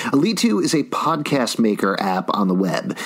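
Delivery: ordinary delivery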